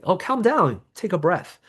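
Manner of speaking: dismissive brush-off. Shown as a demonstration. Coach showing the wrong way to calm someone. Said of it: "How we say the word means a lot".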